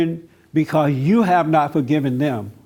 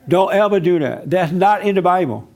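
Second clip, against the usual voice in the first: usual speaking voice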